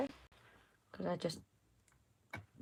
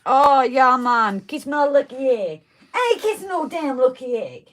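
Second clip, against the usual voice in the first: loud mock Jamaican accent; Cool Runnings quote; 6 semitones higher, 8 dB louder than usual. Quoting the film to a friend in a loud mock Jamaican accent. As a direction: ham up the Jamaican bobsledders. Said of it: "He's referring to Cool Runnings".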